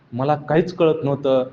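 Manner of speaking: ordinary delivery, nothing marked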